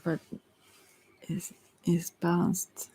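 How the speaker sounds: slow, whispered aside to herself; hushed, drawn-out murmur. Real, not acted. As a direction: breathe it out slowly while reading. Trailing off while reading cards.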